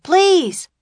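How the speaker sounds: high, loud, desperate plea; pleading yell. A narrator's reading. Jack begs his runaway camel, pleading yell. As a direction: beg at a high-pitched yell. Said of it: "he yelled".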